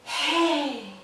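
airy hey, breath escaping; breathy hey. Shown as a demonstration. Coach showing the breathy wrong hey. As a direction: let the hey go weak and airy. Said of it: "these are both extremes".

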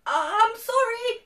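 high, loud, slowed apology; frightened spluttering. A narrator's reading. Shaking dragon apologising to the beast, spluttering. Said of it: "he spluttered".

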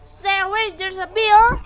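alarmed warning. Real, not acted. Warning child about a bee.

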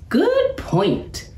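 high, approving professor. A narrator's reading. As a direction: agree brightly with the cub. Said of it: "said the professor".

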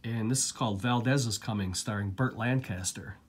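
ordinary delivery

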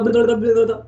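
rapid babbled heartbeat noise; racing heartbeat imitation. Shown as a demonstration. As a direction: babble out a heart beating too fast. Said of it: "We don't want it to go".

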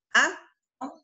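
normal talking voice

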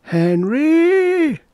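very high and loud, summoning her husband; nagging wife calls her husband from the other room; Agnes calling. Acted. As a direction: yell his name high and sharp. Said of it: "Agnes's voice".

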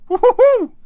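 high loud woo-hoo cheer; excited whoop. Real, not acted. Gamer whooping over a rush of pizza orders. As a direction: whoop like you just won.

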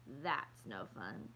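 low, slow, unimpressed; glum grumble. A narrator's reading. Kirsty pointing out the dullness of church, glum. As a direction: drag it out low like a let-down child. Little sister grumbling about sitting still.

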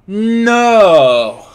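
a sudden, loud, high cry of disbelief; anguished no; dismayed shout. Real, not acted. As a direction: yell it as the shot hits the bar. Crossbar miss in the match.

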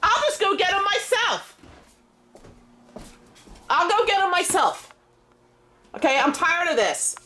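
high exasperated yelling; fed up, shout it upstairs; yelling across the room. Real, not acted. Mom yells back, frustrated.

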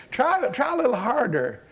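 high-pitched mock coaxing; acted teasing encouragement; taunting Elijah. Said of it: "I can just see Elijah sitting over there saying".

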